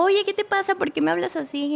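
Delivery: high-pitched, affronted questioning; retold indignant confrontation. Girlfriend confronts boyfriend at his house, indignant. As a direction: act out confronting him, without shouting. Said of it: "pero yo iba enojada".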